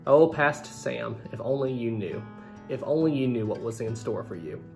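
ordinary talk

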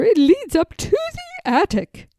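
lightly voiced high, firm governess; emphatic Miss Clavell. A narrator's reading. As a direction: speak like a firm teacher. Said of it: "her voice emphatic".

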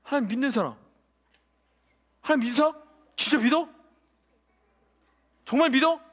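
ordinary talk

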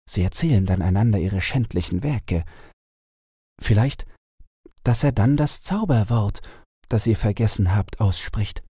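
the enchanted owl telling her sad tale; owl princess voice. A narrator's reading. Owl explains the plan. (traurige Eulenprinzessin, etwas höher)

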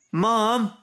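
high, loud wail for mum; crying out. A narrator's reading. Snip calling to his mum, crying out. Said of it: "cried Snip".

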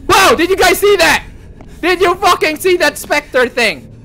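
loud, high astonishment; startled, emphatic yelling; shocked shouting. Real, not acted. Sees a spectre, asks viewers.

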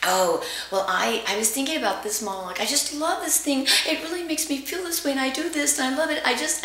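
high-pitched, excited gushing; excited retelling, pitched well up; gushing student. Retold or imitated. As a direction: imitate someone bubbling over with love for it. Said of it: "she was telling me".

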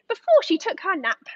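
ordinary delivery, nothing marked

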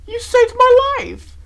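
very high, loud, drawn-out cry; overjoyed gratitude. A narrator's reading. Rarity to the friend who saved her, crying out gratefully. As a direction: gush at full pitch, stretched out.

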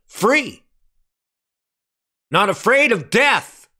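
high, punched-out phrases with pauses between; exultant preaching. Real, not acted. Preacher declaring freedom from fear of death, exultant forceful preaching. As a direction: proclaim each word in triumph to the congregation.

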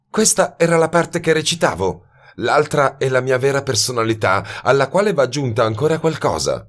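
a woman dropping her act, voiced high and cold; hard young woman. A narrator's reading. Miss Mayfield reveals her true self to the detective, high and defiant.

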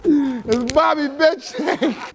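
high, loud catchphrase yell; signature shout. Pretend. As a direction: yell it like on the track. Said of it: "you know how you usually do on your track".

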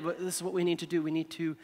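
ordinary delivery

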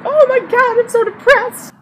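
very high wailing mock despair; mock melodramatic pop star. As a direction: ham up a whiny heartbroken singer. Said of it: "then all the other songs just like".

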